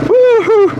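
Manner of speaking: high, loud cheer; excited whoop. Real, not acted. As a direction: whoop of triumph. Cheering on a rough ride.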